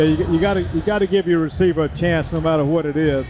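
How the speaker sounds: usual speaking voice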